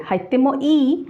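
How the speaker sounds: "হাইতেমো ই" with a stronger rise on the final ই; rising casual question. Shown as a demonstration. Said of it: "ইন্টোনেশনটা একটু জোরে হবে".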